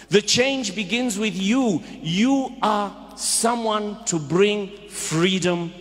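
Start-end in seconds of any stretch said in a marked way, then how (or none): none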